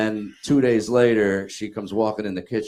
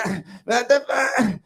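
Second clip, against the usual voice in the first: much higher, louder, whiny mock of the wife; 5 dB louder than usual. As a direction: squeak out her excuse like a put-upon wife. Mocking wife's defensive reply.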